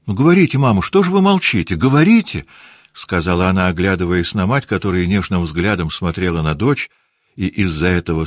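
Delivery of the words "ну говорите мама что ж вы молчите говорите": high, louder, insistent pleading; eager urging. Acted. Наташа нетерпеливо торопит молчащую мать, высоко и громко. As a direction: press mother to speak, high and eager. Natasha urges her silent mother.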